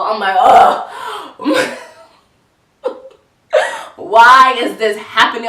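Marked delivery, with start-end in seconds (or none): 0.4-1.8 loud, high-pitched "ugh"; big hammed-up cry of dismay; exasperated mock groan. Reaction to warning of trouble. 4.0-5.5 loud, slow, tearful whine; drawn-out fake-crying lament; mock wail. Acted wail after bad news.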